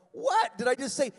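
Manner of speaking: high, loud mock indignation; retold indignation. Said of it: "I'm going".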